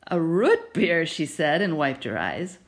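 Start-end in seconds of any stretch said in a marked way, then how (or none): 0.1-1.1 louder chuckling repeat of root beer; laughing to herself. Acted. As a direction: savor the joke with a laugh. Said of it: "still laughing to herself".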